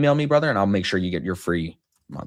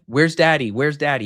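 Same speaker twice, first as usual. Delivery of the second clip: put-on higher, louder kid's call; lightly voiced small child calling out; imitated young child; 6 semitones higher, 6 dB louder than usual. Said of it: "he said to Riley going to bed".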